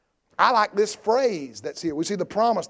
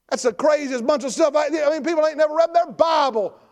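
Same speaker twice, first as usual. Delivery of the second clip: high, forceful pulpit shout; preaching at full force; 7 semitones higher, 4 dB louder than usual. Acted. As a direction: drive each point home to the congregation.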